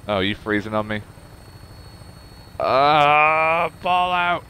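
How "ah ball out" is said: loud, higher, drawn-out groan at the game; exasperated cry. Real, not acted. Gamer crying out at a freezing Fallout, exasperated. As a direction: wail the name in frustration.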